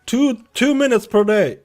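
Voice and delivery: exaggerated American accent, high and loud; mock American accent. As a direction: put on a hammed-up American old-timer voice. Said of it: "What did you think of my American accent?".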